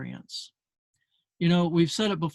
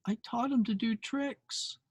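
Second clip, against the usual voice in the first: put-on wistful little girl; 6 semitones higher, 5 dB quieter than usual. Girl voiced softly.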